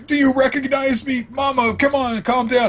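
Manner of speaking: high voiced son begging mama; pleading son. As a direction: beg your mother to calm down. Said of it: "and he says".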